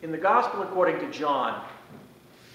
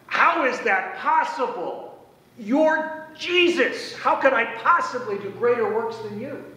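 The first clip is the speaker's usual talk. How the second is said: higher, slowed, incredulous questions; imitating Philip's disbelief; 6 semitones higher than usual. Preacher voicing Philip doubting Jesus's promise, mock incredulous and higher. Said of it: "I can see Philip going".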